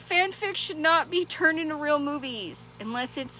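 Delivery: ordinary talk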